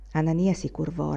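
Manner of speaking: ordinary delivery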